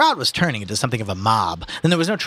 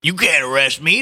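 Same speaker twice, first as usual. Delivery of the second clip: put-on higher, louder defiant old-timer; old man; 6 semitones higher, 5 dB louder than usual. Old man refusing arrest by the policeman, defiant. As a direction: defy the law as an old man. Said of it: "the old man said".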